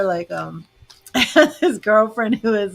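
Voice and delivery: ordinary talk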